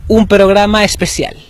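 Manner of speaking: upbeat, fast radio host opening; show host intro. Acted. Host greeting his audience, bright and quick. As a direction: welcome listeners like a lively host.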